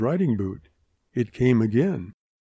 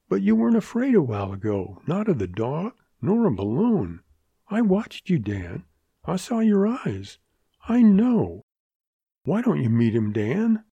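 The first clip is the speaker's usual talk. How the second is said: higher-pitched voice for an insistent heroine; high, swooping character voice, pleading her case; June, earnest and urging; 5 semitones higher than usual. Acted. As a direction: lift the pitch and press him earnestly. June urges Dan on.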